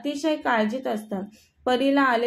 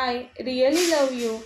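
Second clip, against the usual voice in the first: pleading love confession; much slower than usual. Acted. Medha pleads, trying to hug.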